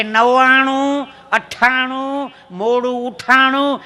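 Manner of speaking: drawn-out number recital; dictating slowly. Retold or imitated. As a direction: stretch each number out slowly. Man slowly dictating his phone number. (લંબાવીને ધીમે number બોલવો)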